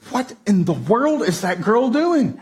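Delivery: slowed, rising mock disbelief; as if you can't believe your eyes; a dad's half-amused double take; stunned disbelief. Dad spots daughter crawling.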